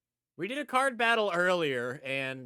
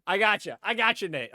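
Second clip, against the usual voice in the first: laughing; 4 semitones higher, 7 dB louder than usual. Real, not acted. Laughing at a mistimed stop.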